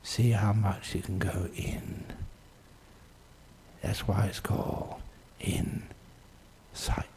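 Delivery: slow, soft, trailing into near-whisper; hushed and spacious, barely voiced; hushed meditation guidance. Real, not acted. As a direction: draw them inward with long pauses. Leads listeners inward, meditation.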